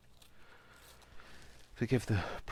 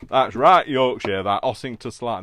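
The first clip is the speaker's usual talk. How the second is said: loud, higher-pitched mock exclamation; acted locals' reply; 5 semitones higher, 14 dB louder than usual. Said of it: "they were like".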